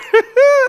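sudden high laugh; burst of laughter. Real, not acted. Pastor laughing at his Nate Robinson joke, a high burst of laughter. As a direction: crack up at your own joke.

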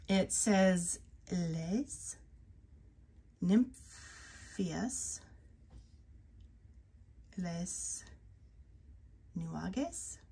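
slowly sounding out Les Nymphias, Les Nuages; unsure French attempt. Real, not acted. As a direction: feel your way through Les Nymphias Les Nuages. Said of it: "I can't say this name".

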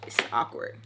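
very soft, low, uncomfortable remark; hushed, almost voiceless discomfort; awkward aside. Retold or imitated. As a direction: say it under your breath. Uncomfortable aside during their flirting.

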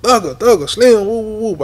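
high, loud hollering of a friend's name; calling out across the mall. Retold or imitated. Spotting Slim in the mall, shouting his name loud and high. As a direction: holler to catch someone far off.

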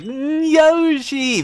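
slow, high mock whimper of Yoshi; hammed-up childish sob of the name; imitating child self crying. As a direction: whimper Yoshi like a kid about to cry. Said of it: "I was like".

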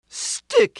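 very high rooster question; rooster asking. A narrator's reading. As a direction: squeak the name up high. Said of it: "he asked".

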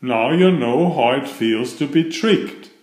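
usual speaking voice

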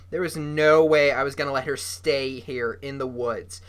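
ordinary delivery, nothing marked

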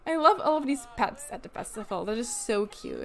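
normal talking voice

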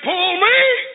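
high demand swooping upward at the end; insistent sister. Acted. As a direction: repeat the order with a rising, insistent lilt.

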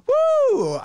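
loud, high whoop of delight; excited whoop. Real, not acted. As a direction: let out a big cheer. Cheering after reading response.

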